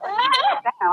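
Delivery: mock squeak. Baby kangaroo still reacting.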